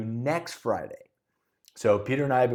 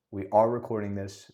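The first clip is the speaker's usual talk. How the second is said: normal talking voice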